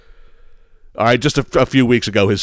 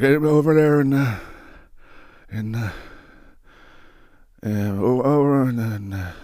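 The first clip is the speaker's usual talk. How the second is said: mock fumbling old man; 5 dB quieter than usual. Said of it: "he's like".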